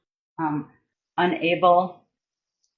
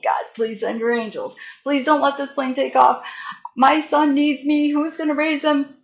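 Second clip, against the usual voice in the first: begging prayer; 6 semitones higher than usual. Retold or imitated. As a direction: plead with God for your son's sake. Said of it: "just was begging God".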